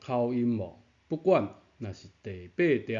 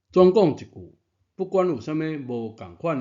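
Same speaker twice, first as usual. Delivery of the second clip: 大声强调; 5 semitones higher, 6 dB louder, faster than usual. Shown as a demonstration. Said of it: "强调总结".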